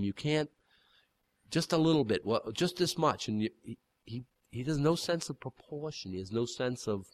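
normal talking voice

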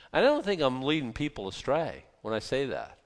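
ordinary talk, nothing marked

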